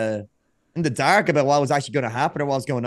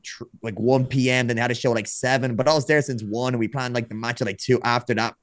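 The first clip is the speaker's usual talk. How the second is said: ordinary talk, nothing marked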